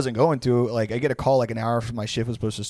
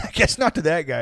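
usual speaking voice

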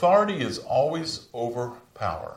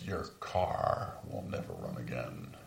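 much lower, softer, sinister whisper-like voice; the devil's lie; 13 semitones lower, 10 dB quieter than usual. Retold or imitated. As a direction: voice the liar low and menacing, hushed. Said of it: "He says".

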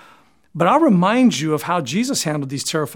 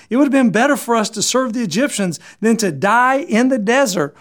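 whining Israelites; 6 semitones higher than usual. Retold or imitated. Israelites complaining to Moses in the desert, high whining. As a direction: whine at Moses like scared, ungrateful people. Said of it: "That's how they responded when they were terrified".

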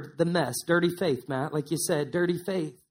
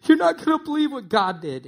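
retold tearful joy; 6 semitones higher than usual. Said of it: "just crying".

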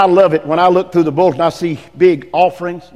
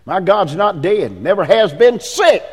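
ordinary talk

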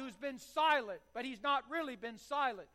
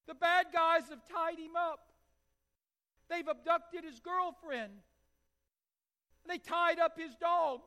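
put-on upset little boy; 4 semitones higher, 4 dB louder than usual. Her little crying boy.

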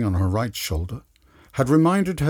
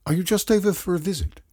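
a hint of a higher, quicker eager voice; lightly voiced woman; 8 semitones higher, faster than usual. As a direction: ask him eagerly. Her voiced line.

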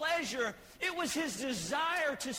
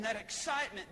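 ordinary talk

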